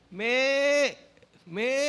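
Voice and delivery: high mock goat bleating. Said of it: "Sumunod naman yung kambing".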